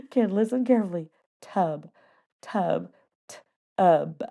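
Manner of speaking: tub with a crisp B and no uh after it; careful correct tub. Retold or imitated. Teacher correcting the first grader, tub with a crisp B. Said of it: "And I am like".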